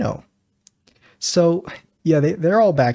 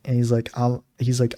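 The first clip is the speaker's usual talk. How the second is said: ordinary talk, nothing marked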